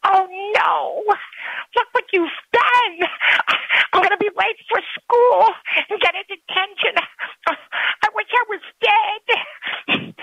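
put-on little girl crying out in panic; panicked little girl. A narrator's reading.

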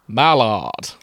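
hammed-up, booming actor's call; Matt Berry. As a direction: relish the name like a theatrical actor. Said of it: "He's getting Matt Berry".

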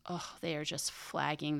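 breathy near-whisper, sympathetic; hushed, pitying aside. Real, not acted. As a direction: drop to a whisper at the players' exhaustion. Coaches watching exhausted players.

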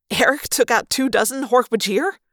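amazed, pitch climbing at the end; incredulous question. A narrator's reading. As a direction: question it as if it were absurd. Disbelief, almost laughed.

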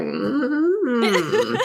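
high sassy sing-song mm-hmm with a laugh; Scream Queens gif mm-hmm. Retold or imitated. Co-host imitates the Scream Queens gif about Lauren, sassy high mm-hmm. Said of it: "that gif from Scream".

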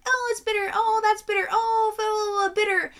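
high, mocking whine of her own griping; whiny complaining. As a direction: parody yourself moaning about bitter beer. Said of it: "I'm like".

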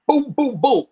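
high, punchy booms; imitated door knocks. Sudden knock interrupts men having a beer, pounding booms. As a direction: voice each knock as a separate hit.

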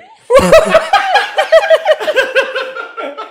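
very loud, high shrieking laughter; real laughing fit. Real, not acted. Man cracking up at his Morgan Freeman joke, shrieking laughter. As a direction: burst into helpless high-pitched cackling.

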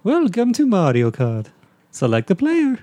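high game-menu announcer in cartoon voice; sing-out like the game plumber; Mario. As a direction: falsetto game-mascot voice. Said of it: "The voice of Mario".

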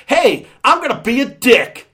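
high, loud mock announcement; mock brash. Reviewer voicing the obnoxious movie's attitude, mock brash.

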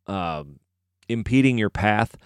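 ordinary talk

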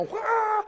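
high-pitched cartoonish wail; mock wail. Preacher imitates chaos in the house with a high wail. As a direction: let out a high imitated howl.

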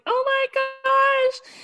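very high, drawn-out squeal; mock dismay. Imitating Gina chasing after her, high drawn-out dismay. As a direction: stretch it out in hammed-up dismay.

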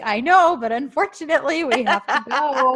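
loud, high, hammed-up regret; mock regret. Host retells him getting her out of the pool, mock regret. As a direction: insist with exaggerated false regret. Retells his forced polite insistence.

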